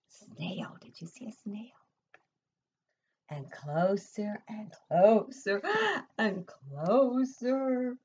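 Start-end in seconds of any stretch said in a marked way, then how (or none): 3.3-5.6 slow building suspense. A narrator's reading. 5.7-6.1 gasp. Acted. Suspense in story. 6.2-8.0 slow building suspense. A narrator's reading.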